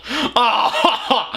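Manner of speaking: usual speaking voice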